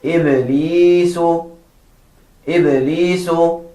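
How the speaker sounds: "Iblisu" drawn out with a long "lee"; slow teacher's demo of "Iblisu"; careful pronunciation model. Shown as a demonstration. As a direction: say "Iblisu" slowly, holding the middle vowel level. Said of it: "Listen carefully".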